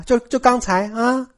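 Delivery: usual speaking voice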